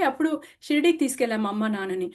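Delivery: ordinary talk, nothing marked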